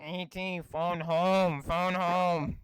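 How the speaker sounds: nasal alien croak. Retold or imitated. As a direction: squeeze it out like E T. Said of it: "I don't have the nasal capacity".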